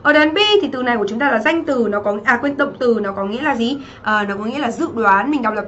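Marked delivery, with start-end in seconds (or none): none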